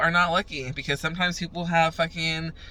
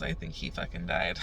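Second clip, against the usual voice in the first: normal talking voice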